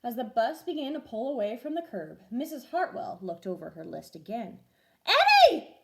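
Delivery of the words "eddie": loud high shout across the bus; frantic teacher shout; yelling. A narrator's reading. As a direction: yell to stop him in time. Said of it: "she yelled".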